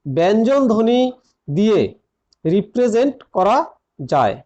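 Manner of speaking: word by word, with long pauses; dictation pace. Real, not acted. Teacher dictating a grammar point to students, phrase by phrase. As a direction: dictate it in small separate chunks.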